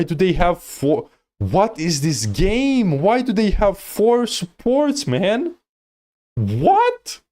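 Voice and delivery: high, drawn-out disbelief, climbing to a squeal; incredulous outburst. Real, not acted. Coach spotting four supports on the enemy team, incredulous high-pitched outburst. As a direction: let bafflement push the voice way up.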